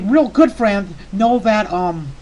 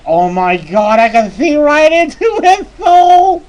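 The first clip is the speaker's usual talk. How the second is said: awestruck outburst; 6 semitones higher, 6 dB louder, slower than usual. Retold or imitated. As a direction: build to a high, slow cry. Retold awe at horse's eyes.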